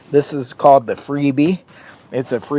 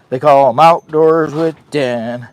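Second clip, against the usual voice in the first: usual speaking voice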